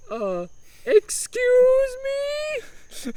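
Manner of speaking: very high, drawn-out outraged Italian "excuse me"; upset mock scream. Humdinger screaming at the window woman, upset. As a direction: shriek it out slowly in disbelief. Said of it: "Humdinger screamed, upset".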